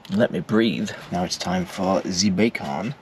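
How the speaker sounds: ordinary talk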